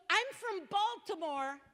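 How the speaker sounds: high, loud flustered imitation; flustered young man. Speaker imitating the flustered petitioner, high and loud. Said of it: "he goes".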